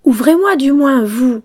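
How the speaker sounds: loud, high plea; tearful cry. A narrator's reading. Pinocchio begs the girl to open, crying out tearfully. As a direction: cry out to be let in. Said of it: "s'écria Pinocchio en pleurant".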